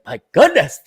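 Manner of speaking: a hint of a fussy pharaoh; mock camp sigh. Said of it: "he's just like".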